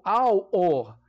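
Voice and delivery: "alor" drawn out slowly, split with no LH sound; wrong pronunciation. Shown as a demonstration. Teacher showing a wrong reading with silent H, slow mispronunciation. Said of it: "O H não sendo pronunciado".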